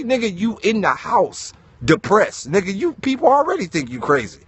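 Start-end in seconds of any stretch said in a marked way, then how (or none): none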